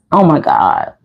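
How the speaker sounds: ordinary talk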